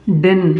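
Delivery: डिन said as "din", the t dropped; डिन with no t sound; saying didn't. Shown as a demonstration. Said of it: "और कहलाएगा".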